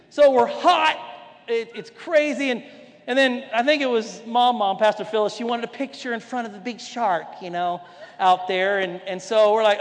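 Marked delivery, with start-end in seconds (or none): none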